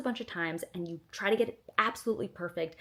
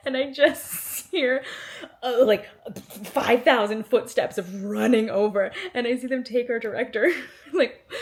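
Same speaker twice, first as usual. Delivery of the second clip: laughing; 6 semitones higher, 9 dB louder than usual. Real, not acted. Laughing while recalling executives running.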